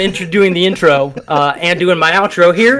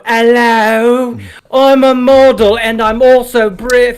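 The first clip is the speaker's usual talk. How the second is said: a hint of put-on British model voice, higher; fake British model; 4 semitones higher, slower than usual. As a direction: pose as a British model, lightly hammed. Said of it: "I like to act like a model ... fake British".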